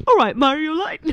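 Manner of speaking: high, loud Paisley-accent impression; Liam. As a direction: call it out in a put-on Paisley accent. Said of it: "I think it was almost Liam".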